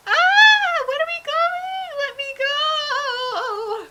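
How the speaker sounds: very high, very loud, drawn-out panicked cry; terrified scream. Acted. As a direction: shriek to be let go while being carried away. Terry carried off screaming.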